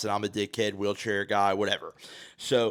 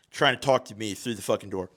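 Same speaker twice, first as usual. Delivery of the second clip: usual speaking voice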